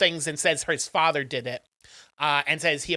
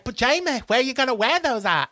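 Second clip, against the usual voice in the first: higher, put-on English accent; imitation English accent, pitched up; 7 semitones higher than usual. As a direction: act out an English friend's puzzled question. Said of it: "I'm doing an English accent".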